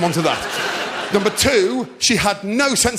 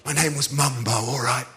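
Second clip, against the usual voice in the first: reluctant confession; 6 semitones lower than usual. Retold or imitated. Embarrassed man giving up his nickname, low and sheepish. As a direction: own up grudgingly, voice dropped. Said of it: "And he went".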